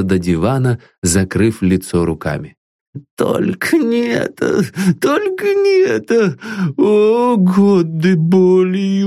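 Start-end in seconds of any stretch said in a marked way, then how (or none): 3.2-4.6 high, pleading plea; begging. Acted. Terrified master begs his servant, pleading. 5.0-6.4 much higher, sobbing cry. Acted. Terrified master sobs his plea to his servant. Old man breaking down.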